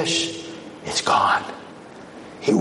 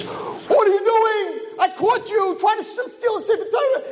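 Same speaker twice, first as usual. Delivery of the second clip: accusing. Acted. Rabbi confronting a man at the ark, accusing. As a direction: catch the thief in the act. Rabbi catches a supposed thief.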